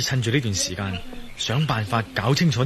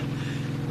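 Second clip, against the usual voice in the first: soft laugh; 7 dB quieter than usual. Acted. Wesley amused by Jack's greeting.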